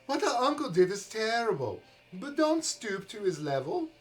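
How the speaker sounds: put-on high, earnest pleading young man; pleading brother. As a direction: plead with her to stop. Philip's pleading lines.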